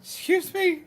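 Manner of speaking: high incredulous excuse me; indignant mock retort; offended disbelief. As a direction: act stunned and offended. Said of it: "and I'm like".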